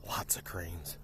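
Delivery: hushed whisper, as if not to scare the birds. Real, not acted. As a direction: drop to a soft whisper. Spotting cranes, hushed aside.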